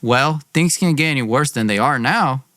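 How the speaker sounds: plain reading voice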